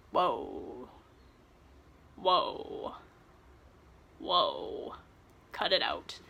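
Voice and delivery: very low, soft mock-male voice; Hardin calling halt. Reader imitates Hardin stopping Tessa, hammed-up low voice. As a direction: drop deep and quiet, spacing out each protest.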